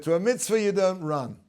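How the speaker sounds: grandmother's shouted reply; calling out. Retold or imitated. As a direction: call a rebuke after them, loud and high. Grandmother calls back to running children.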